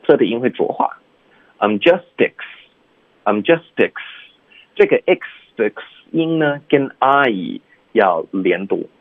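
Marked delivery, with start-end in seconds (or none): 1.6-3.9 "I'm justice" slow, with the t of "just" run straight into the vowel of excited; "I'm justice" drawn out, t softened into a d sound; linked as justice. Shown as a demonstration. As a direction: say "I'm justice" slowly, t voiced and joined on. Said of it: "所以就变成justice".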